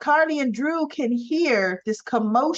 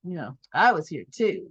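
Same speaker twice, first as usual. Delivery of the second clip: imitated coyness; 4 semitones lower than usual. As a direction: play it coy, low and halting. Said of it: "coy expression on her face".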